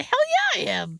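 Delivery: gleeful defiant hell yeah; emphatic yes. Retold or imitated. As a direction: shoot up high and loud on hell, then drop. Defiant answer to her mother.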